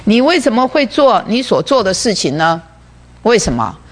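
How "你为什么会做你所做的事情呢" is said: usual speaking voice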